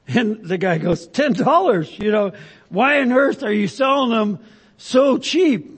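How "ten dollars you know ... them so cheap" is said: astonished buyer. Acted. Joke, buyer shocked at price.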